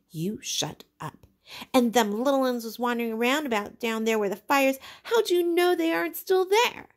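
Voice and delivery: rising to a loud, high angry shout; a boy snapping, then yelling at Piggy; angry Jack. Acted. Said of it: "Jack turned on him".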